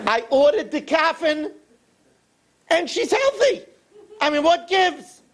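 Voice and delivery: baffled he is still waiting; whiny complaint. Acted. Rich man complains to Rebbe.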